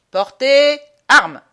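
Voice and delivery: slow, high, very loud military order; drawn-out drill sergeant's shout; drill command. Acted. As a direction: bellow it across the parade ground. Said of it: "L'on nous commande à l'exercice".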